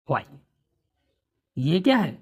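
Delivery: normal talking voice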